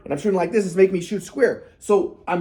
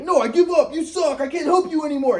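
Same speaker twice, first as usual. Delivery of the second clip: higher, exasperated mock dad; dad giving up; 8 semitones higher than usual. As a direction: toss it off like a fed-up father. Dad refusing to help.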